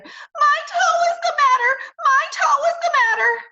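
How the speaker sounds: high, loud wailing holler. Acted. Junie hollers to her parents about her toe, high loud wail. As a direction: wail it out as loud as a hurt kid can. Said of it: "I hollered back".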